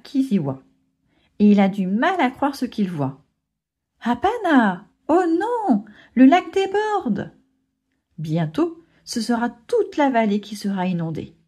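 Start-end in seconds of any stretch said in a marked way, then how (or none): 4.0-7.3 alarmed bird. Acted. Bird sees lake overflowing.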